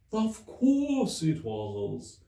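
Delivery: high, mournful, slow sea-creature voice; Mock Turtle. A narrator's reading. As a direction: sob out the memories like a weepy old turtle.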